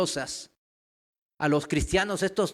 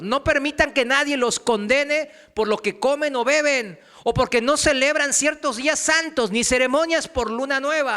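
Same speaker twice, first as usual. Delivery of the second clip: raised, loud, ringing verse; forceful scripture reading; 7 semitones higher, 6 dB louder than usual. Real, not acted. Preacher reads Paul telling believers not to be condemned, forceful reading. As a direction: proclaim the verse loudly on a high pitch.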